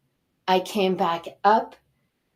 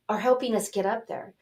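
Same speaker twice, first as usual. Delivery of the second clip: usual speaking voice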